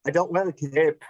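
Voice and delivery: bad Scottish accent; hammy Scottish accent. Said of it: "that was a terrible Scottish accent".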